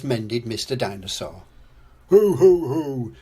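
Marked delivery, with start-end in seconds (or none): 2.1-3.1 loud ho-ho chuckle, starting high; hammed-up jolly father's chuckle. A narrator's reading. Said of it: "chuckles Daddy Pig".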